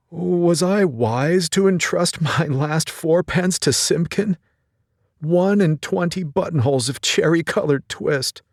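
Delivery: a worried tailor counting stitches aloud; old tailor mumbling. Acted. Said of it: "mumbling to himself".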